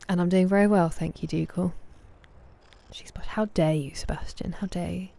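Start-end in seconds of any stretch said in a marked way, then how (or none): 2.9-5.1 whispered playful how dare you; mock outrage. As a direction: act scandalized in a hush.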